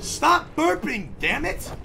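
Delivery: loud, high, slow scolding; exasperated yell. Pretend. Dad ordering Kevin to stop burping, exasperated yell. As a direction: stretch out the order in an exasperated shout.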